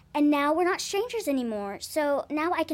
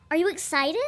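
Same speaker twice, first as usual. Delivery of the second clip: ordinary talk, nothing marked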